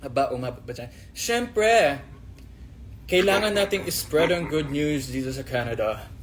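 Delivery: normal talking voice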